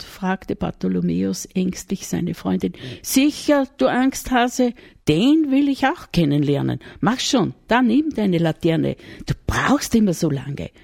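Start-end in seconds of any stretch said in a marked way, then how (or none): 3.0-10.7 laut scheltend, ungeduldig. A narrator's reading. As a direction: schimpf ihn aus und treib ihn an. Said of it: "schalt sie ihn". (laut scheltend)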